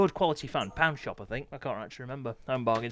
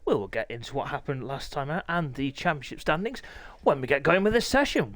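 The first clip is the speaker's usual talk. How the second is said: ordinary talk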